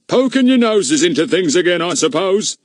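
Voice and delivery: high, loud, scornful constable's voice; sneering policeman. A narrator's reading. Policeman accusing the children of meddling, scornful and high. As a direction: scoff like a pompous village bobby.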